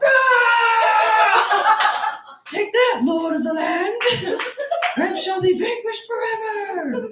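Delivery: hammed-up heroic knight shouting, high and loud; mock battle cry. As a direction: proclaim it like a hero slaying a dragon.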